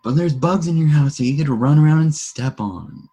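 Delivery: high, loud eager pitch; excited. Real, not acted. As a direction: sell it like a great feature. Excited about game.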